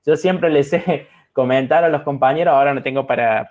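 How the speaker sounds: ordinary talk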